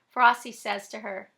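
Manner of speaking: ordinary delivery, nothing marked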